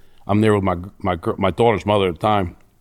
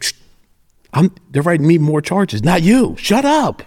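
mock snap; 8 semitones higher, 4 dB louder than usual. Retold telling girlfriend to shut up.

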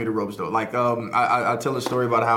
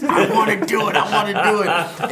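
eager; 6 semitones higher, 4 dB louder than usual. Retold or imitated. Barely holding in excitement.

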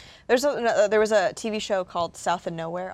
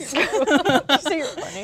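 mock indignant; 8 semitones higher, 4 dB louder, slower than usual. Real, not acted. Mock indignant after insult.

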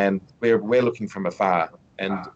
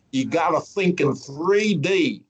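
put-on Texan accent; 7 semitones higher, slower than usual. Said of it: "in his Texan accent".

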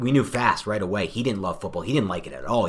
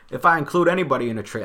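a hint of a team GM's voice, higher and louder; half-hearted GM impression, pitched up; bad Gettleman impression; 6 semitones higher, 5 dB louder, faster than usual. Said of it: "I'm so bad at the Gettleman accent".

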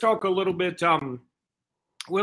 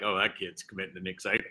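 doctor voice; 8 semitones lower, 7 dB quieter than usual. Retold or imitated. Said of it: "the doctor was like".

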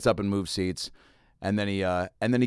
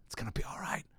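whisper; 11 dB quieter than usual. Retold or imitated. Soothing the angry passenger.

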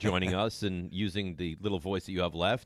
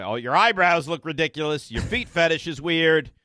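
mocking imitation; 6 semitones higher, 5 dB louder than usual. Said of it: "and then you'll be".

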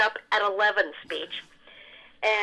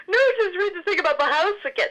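excited producers; 10 semitones higher, 4 dB louder than usual. Retold or imitated. Laughing producers ask for repeat.

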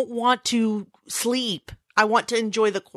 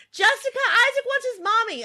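high-pitched mock call; [name]; 13 semitones higher than usual. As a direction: call someone in a high pretend voice. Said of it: "I'm like".

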